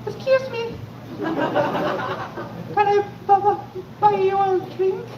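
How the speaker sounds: squeaky, very high, stammering ask; a nervous kid squeaking out the question. Retold or imitated. Nervous boy asks girl for drink.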